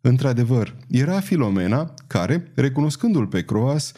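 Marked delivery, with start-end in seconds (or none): none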